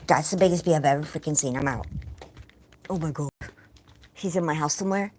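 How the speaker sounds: ordinary delivery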